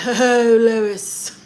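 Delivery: loud, hammed-up cartoon voice; badly done cartoon impression, belted out; Stewie from Family Guy. Streamer attempting a cartoon character calling Lois, loud hammy impression. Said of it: "That was a horrible stew".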